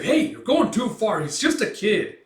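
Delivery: loud high shout to stop; alarmed protest. Acted. Mercenary protesting Gambino's attack, loud alarmed shout. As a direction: cry out to stop him.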